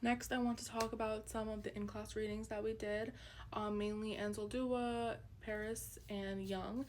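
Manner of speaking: ordinary talk, nothing marked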